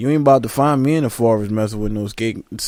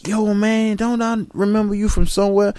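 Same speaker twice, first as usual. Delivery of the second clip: excited southern greeting; 9 semitones higher than usual. Retold or imitated. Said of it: "be like".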